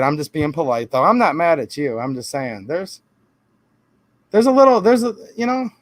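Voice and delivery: usual speaking voice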